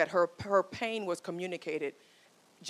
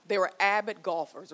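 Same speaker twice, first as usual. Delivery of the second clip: ordinary talk, nothing marked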